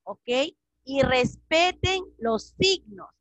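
normal talking voice

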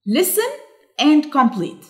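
normal talking voice

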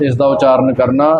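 ordinary talk, nothing marked

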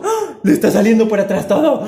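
loud, laughing outburst; gasp, then blurt it out loud and fast; real startled hilarity; amused shock. Real, not acted. Shocked as bird poops.

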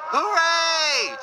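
high, loud, drawn-out cheer. A narrator's reading. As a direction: cheer like a crew of workmen. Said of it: "cheered the workmen".

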